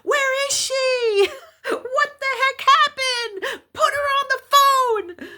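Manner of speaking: very high, drawn-out frantic shrieking; imitated hysterical yelling. Friend yelling down the phone for answers, imitated hysterical shriek. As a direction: shriek it like a man losing it on the phone. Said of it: "He's hysterical".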